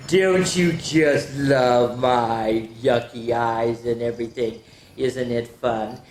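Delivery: low, slow spooky put-on voice; mock creepy drawl. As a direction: play the creepy monster for the kids. Spooky costume voice for kids.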